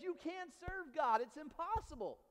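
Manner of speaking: high, emphatic preaching cry; Joshua's blunt challenge. Acted. Preacher as Joshua rebuffing the people, high and emphatic. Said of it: "Joshua says".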